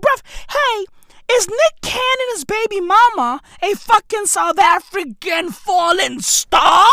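high, hammed-up disbelief, slowing at the end; mock incredulous outburst. Ranter mocks baby mama's plea, high incredulous squeal. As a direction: squeal it like you can't believe it. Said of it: "I was like".

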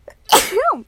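loud sudden pepper cough; sneezy cough. Real, not acted. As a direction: cough hard, tickled throat. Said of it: "I'm sneezing so much".

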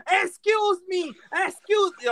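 very high, screechy catchphrase impression; Eddie Guerrero's wife. Podcaster imitating an annoying wrestler's wife, high-pitched screech. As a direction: imitate an irritating wrestling villainess, high and stretched out. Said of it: "Eddie Guerrero's wife. When she".

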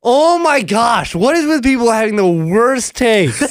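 scandalized, pitch way up; exasperated outrage. Real, not acted. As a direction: cry out at a terrible opinion. Outrage at mashed potato take.

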